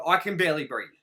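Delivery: usual speaking voice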